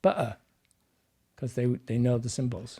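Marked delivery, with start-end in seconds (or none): 0.0-0.4 "Butter" pitched up, the t a catch in the throat; glottal stop. Shown as a demonstration. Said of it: "and they would go".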